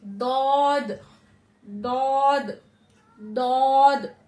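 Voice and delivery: दौद pressed heavy and loud from the tongue's side; teacher drilling Daad for students; Daad demo. Shown as a demonstration. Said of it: "हल्का उसको दबाते हुए".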